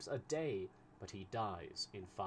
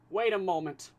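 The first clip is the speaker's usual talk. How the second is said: Fix, much higher and louder, holding him back; Fix urgent; 10 semitones higher, 11 dB louder than usual. A narrator's reading. Fix grabs Passepartout's arm, urgently loud. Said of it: "Fix caught him by the arm and said".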